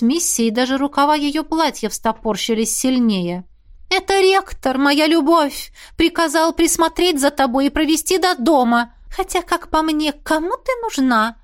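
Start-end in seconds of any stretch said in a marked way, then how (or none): 3.9-11.4 put-on light, high, teasing ghost girl. A narrator's reading. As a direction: play a chirpy, haughty ghost girl. Ghost Missy voiced high.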